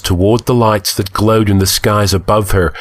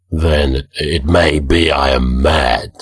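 slow, low, dazed admission; lost in a daze, doubting himself; 5 semitones lower, slower than usual. A narrator's reading. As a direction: lower and slower, as if stunned. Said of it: "said Conan dazedly".

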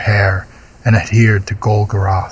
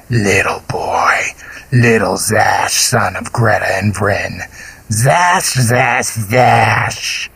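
slow, hungry creature whisper; monster whisper; slower than usual. A narrator's reading. Golgoroth crooning to the princess in a slow creature whisper. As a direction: whisper like a beast savoring prey.

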